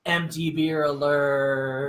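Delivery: slow, drawn-out announcement; mock alert. Host warns the beer is empty, mock alert. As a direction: stretch it out like a siren call.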